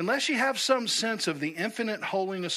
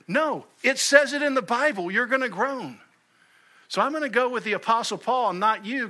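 raised, higher defiant comeback; mock indignant rebuttal, higher; fired-up retort; 7 semitones higher, 4 dB louder than usual. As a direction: answer the wacko back with a punch. Preacher rebuts the wacko.

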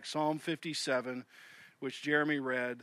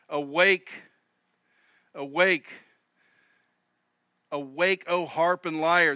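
louder, ringing call to wake up; turned-up praise; 6 dB louder than usual. Retold or imitated. David turning up his song in the cave, loud joyful call. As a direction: rouse the harp with loud joy. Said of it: "let's turn it up a few notches".

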